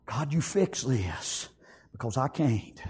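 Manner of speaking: hushed, low surrender; helpless prayer. Acted. Preacher handing his trouble to God, hushed helpless plea. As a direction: drop right down, soft and low, to plead.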